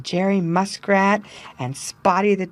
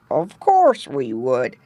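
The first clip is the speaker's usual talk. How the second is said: put-on lightly gruff old frog voice; a grumpy old frog agreeing; 4 semitones lower than usual. A narrator's reading. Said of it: "said a gruff voice".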